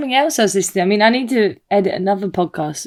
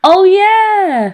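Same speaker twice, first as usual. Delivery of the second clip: high, louder oh yeah; light up at the realisation; realising; 11 semitones higher, 5 dB louder than usual. Real, not acted. Realising the nails give it away, high and loud.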